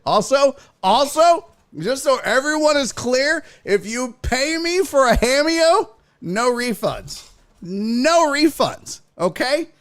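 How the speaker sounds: high-pitched, insistent proclamation; emphatic declaration. Pretend. Streamer warning paying viewers about refunds, emphatic and high. Announcing a no-refund rule to chat.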